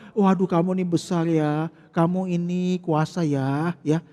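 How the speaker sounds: butter him up to soften his heart; fawning flattery. Acted. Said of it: "cara membongbongi".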